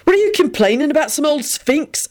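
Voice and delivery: high-pitched irritated interjection; irritable. A narrator's reading. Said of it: "Benji put in irritably".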